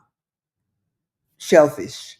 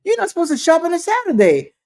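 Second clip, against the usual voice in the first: high, fast mock accusation; roasting him; 7 semitones higher, much faster than usual. Woman roasting the guest about shopping, high and fast. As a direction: rattle it off like a gleeful accuser. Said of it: "I think she wanted to roast him".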